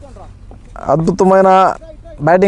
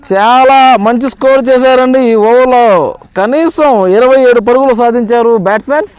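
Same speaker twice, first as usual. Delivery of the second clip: excited commentary; 3 semitones higher than usual. Real, not acted. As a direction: celebrate the milestone with energy. Fifty reached, urging raise bat.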